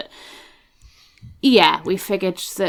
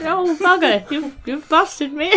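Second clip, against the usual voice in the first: put-on high voice; 11 semitones higher, 4 dB louder, slower than usual. Character caught out, put-on voice.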